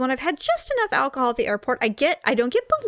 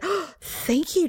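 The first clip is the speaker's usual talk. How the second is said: high, slow, drawn-out gratitude; hammed-up grateful gasp; gushing thanks; 6 semitones higher, slower than usual. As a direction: gush with theatrical relief. Retold gushing thanks to stranger.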